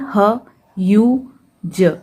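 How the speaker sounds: very slow, separate sounds ह, यू, ज; sounding out letters. Shown as a demonstration. Teacher sounding out a word for pupils, very slow and separated. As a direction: spell ह्यूज out sound by sound, drawn long. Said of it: "तो इसे हम कैसे पढ़ेंगे?".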